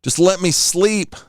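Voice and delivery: whiny plea. Retold or imitated. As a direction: beg to sleep like a worn-out kid. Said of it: "I'm like".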